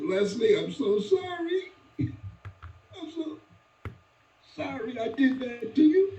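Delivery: high, slow tearful apology; mother begging forgiveness after the beating; mother apologizing. Retold or imitated. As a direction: plead forgiveness from your son. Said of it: "He said".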